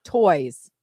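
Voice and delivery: ordinary talk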